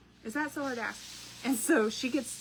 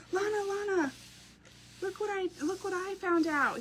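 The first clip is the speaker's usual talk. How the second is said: put-on higher, eager kid voice calling his sister; excited little boy; 10 semitones higher than usual. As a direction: pipe up like a proud little brother. Mom voicing her excited son.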